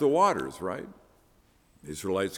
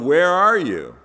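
loud, raised cry to God; yelling out; 6 semitones higher, 10 dB louder than usual. Acted. Believer yells out to an absent God, loud raised cry. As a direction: call out loudly and higher, demanding an answer. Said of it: "we can yell out".

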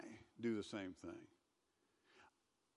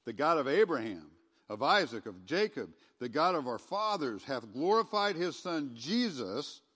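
loud, proclaiming King James reading; scripture reading; 7 dB louder than usual. Acted. Pastor reading Acts to the church, loud proclaiming. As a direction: declaim it like Peter preaching. Reads Acts aloud forcefully.

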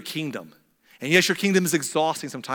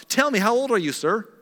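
higher, eager curious king; eager king asking; 7 semitones higher, 3 dB louder than usual. Retold or imitated. Pharaoh asks the old father his age, eagerly curious. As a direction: lean in, delighted to meet him. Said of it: "He's like".

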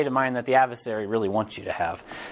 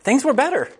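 laughing; 9 semitones higher than usual. Retold or imitated. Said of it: "he was like".

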